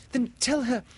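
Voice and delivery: put-on high, urgent girl's plea; pleading high as a worried girl; begging. A narrator's reading. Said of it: "Wendy begged".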